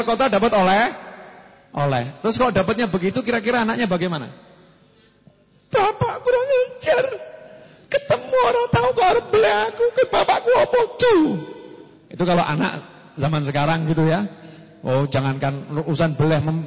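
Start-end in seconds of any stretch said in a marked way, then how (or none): 5.7-11.2 put-on high child's voice, crying out and laughing; high wailing child protest; outraged child. Modern child scolds father over the sacrifice, high wail.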